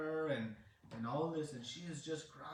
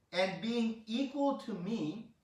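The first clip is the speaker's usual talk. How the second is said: slow, loud, raised-pitch decree; divine proclamation; 7 semitones higher, 5 dB louder, slower than usual. Retold or imitated. Krishna raises the month to his level, loud slow proclamation. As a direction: declare equality grandly and slowly.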